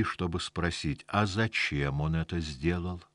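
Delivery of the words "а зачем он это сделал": ordinary delivery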